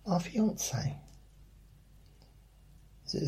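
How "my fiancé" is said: much higher, preening "My fiancé"; mocking coworker. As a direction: lift it up high, all smug. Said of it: "I used to work with someone that".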